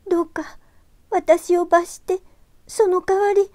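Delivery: put-on high, pleading girl's voice; 必死の祈り. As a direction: beg desperately as a young girl. ユキが神仏に必死に祈る. (必死に懇願する少女の声)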